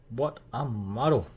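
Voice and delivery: usual speaking voice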